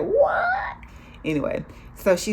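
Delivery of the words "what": drawn-out, very high rising wail; hammed-up high choral disbelief; choir-like mock shock. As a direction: join in like a choir echoing astonishment. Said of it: "I was like the choir back there".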